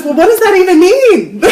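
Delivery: high, loud, laughing disbelief; mock him, squeaky and amused; incredulous friend. Friend asking about her retreat: high laughing incredulity. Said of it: "And he goes".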